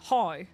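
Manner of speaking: "how" said as "hoy"; local vowel. Shown as a demonstration. Explaining weird vowel sounds, dialect pronunciation. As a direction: give "how" a Northern Irish "hoy" vowel. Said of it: "Our vowel sounds are weird".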